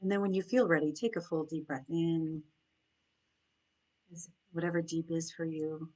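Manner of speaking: soft, unhurried meditation voice with long pauses; guided meditation. Acted. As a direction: guide them gently into noticing their breath and senses.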